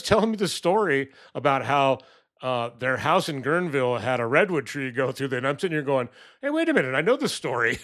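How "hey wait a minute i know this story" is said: sudden high squeak of recognition; surprised realization. Retold or imitated. Retelling to viewers, sudden surprised high voice. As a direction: catch yourself mid-story, amazed. Realizing he knows neighbor's story.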